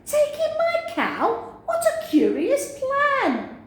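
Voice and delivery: very high, astonished; old woman, astonished. Acted. Old woman astonished at the wise man's advice, very high. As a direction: squeal the question in disbelief.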